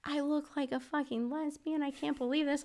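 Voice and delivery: high, softened mock shriek; mock scream. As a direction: squeal it out in retold outrage, held back in volume. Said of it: "And, like, I'm screaming".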